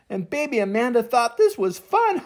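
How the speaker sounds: ordinary delivery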